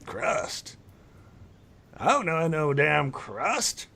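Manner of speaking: put-on high old elf scoff; scoffing elder. Old elf denying he knows Krusk. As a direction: dismiss the name like a grumpy elder.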